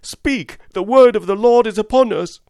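high, loud defiant cry; top-of-voice shout. Acted. Curate defies the narrator, shouting at the top of his voice. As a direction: bellow it as loud as you can. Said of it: "shouted the curate at the top of his voice".

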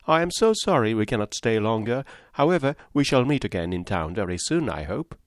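chattering Mrs Palmer. Acted. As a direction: prattle on brightly without pausing.

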